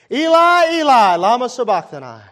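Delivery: very high, very loud anguished cry. Acted. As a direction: cry out to God from the cross. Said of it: "Jesus cried with a loud voice".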